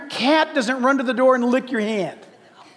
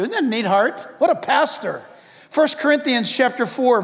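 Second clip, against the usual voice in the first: ordinary talk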